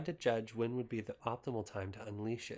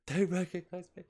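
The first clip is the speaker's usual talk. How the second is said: rereading the line and cracking up; laughing; 7 semitones higher, 6 dB louder than usual. Real, not acted. Reader laughing at her own book, breaking up mid-word. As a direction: laughs through the words, higher and louder.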